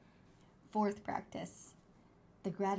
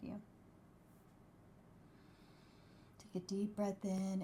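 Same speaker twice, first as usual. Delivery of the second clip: soft spa whisper; 7 dB quieter than usual. Acted. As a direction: ease them into calm, softly. Leads a gratitude meditation.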